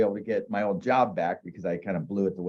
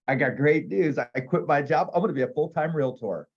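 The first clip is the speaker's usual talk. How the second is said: higher, bright-eyed eager news; eager announcement; 5 semitones higher than usual. Retold or imitated. Telling the secretaries he quit, eager and proud.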